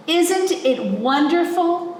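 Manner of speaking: high, gushing admiration; an enthusiast marvelling at the bird; high and rapturous; delighted birdwatcher. Acted. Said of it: "said one of the people".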